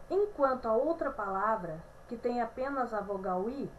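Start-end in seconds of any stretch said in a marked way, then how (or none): none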